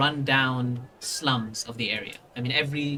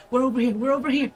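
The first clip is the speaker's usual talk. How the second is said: put-on fast, high, louder child voice; eager child guide; 10 semitones higher, 5 dB louder, much faster than usual. Child urging the party onward, fast high calls. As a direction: call out quickly, pitched up. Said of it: "he just continues to say".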